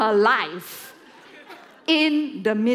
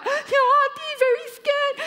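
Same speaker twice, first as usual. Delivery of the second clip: imitated terrified girl; 14 semitones higher than usual. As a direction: squeal it like a panicking girl. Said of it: "She's like".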